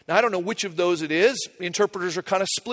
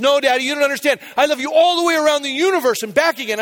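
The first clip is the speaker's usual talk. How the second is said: higher-pitched imitation of a child one-upping; emphatic retold child's declaration; insistent little girl; 6 semitones higher, 4 dB louder than usual. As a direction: push it higher, eager to out-love Daddy. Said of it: "She goes".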